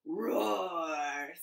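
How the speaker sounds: mock lion roar in a put-on voice. Librarian reads Peek-a-Zoo to kids, mock lion roar. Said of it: "says the lion".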